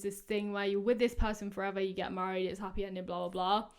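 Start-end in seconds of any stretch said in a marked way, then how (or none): none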